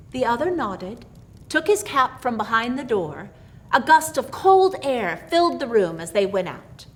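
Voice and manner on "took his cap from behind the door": ordinary delivery, nothing marked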